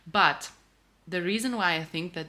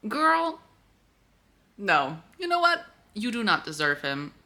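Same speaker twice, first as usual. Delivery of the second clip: sassy scold; 4 semitones higher, 4 dB louder than usual. Real, not acted. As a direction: tell the heroine off with sass. Scolding book character.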